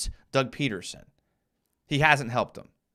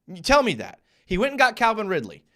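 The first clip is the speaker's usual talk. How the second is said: ordinary delivery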